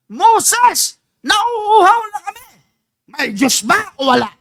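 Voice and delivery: high, loud whiny complaint; complaining Israelites. Retold or imitated. The Israelites complaining to Moses in the desert, loud high whine. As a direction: whine at Moses like thirsty grumblers. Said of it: "parang ang Diyos ay walang ginagawa".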